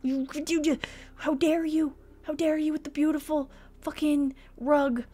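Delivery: theatrical how-dare-you rant; mock outrage. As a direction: scold the house like a diva.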